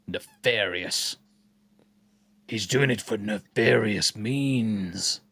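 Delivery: low, slow, playful villain voice; mock sinister. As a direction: savour each word like a scheming villain. Savours the word in mock-sinister voice.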